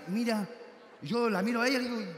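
ordinary talk

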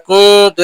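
কুনতু repeated with nasal hum on the nun; ghunna isolated. Shown as a demonstration. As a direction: drill the nasal কুনতু alone. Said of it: "এই যে কু".

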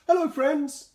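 high, loud friendly call. A narrator's reading. Noko calls into the silent village, calling loudly. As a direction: call out across the empty village. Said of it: "he called".

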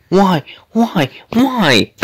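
loud, high repeated wail; exasperated cry. Real, not acted. Gamer protesting a laggy failed jump, exasperated high cry.

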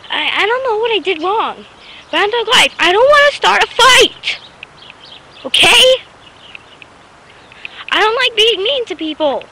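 real upset outburst; upset, emotional. Real, not acted. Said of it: "I'm getting emotional".

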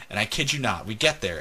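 ordinary talk, nothing marked

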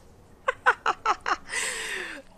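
loud, high burst of laughter. Real, not acted. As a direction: laugh out loud at your own blunder. Laughs at own blunder.

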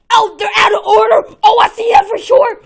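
loud, annoyed yelling; frustrated shout. Real, not acted. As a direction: shout at the broken machine. Vending machine broken.

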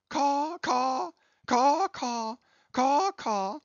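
high cawing like a crow. Acted. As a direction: caw out from a treetop. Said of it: "I'm a crow".

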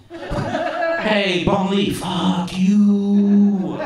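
high, loud, very drawn-out greeting; cheery calling out. Retold or imitated. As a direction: holler it as a friendly hello, stretched long. Replaying the hearty call to coworker.

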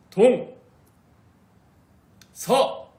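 very loud, high earnest shouts; dead serious shout. Shown as a demonstration. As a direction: yell each word with total conviction. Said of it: "되게 진지하게 해야 돼".

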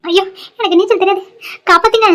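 high, frightened cry for rescue; drowning man's high panicked plea. Acted. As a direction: beg to be pulled out of the water. Drowning man cannot swim.

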